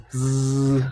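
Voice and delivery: sleepy snore sound; snoring. Acted. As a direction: make a snoring noise. Picture of sleeping lion.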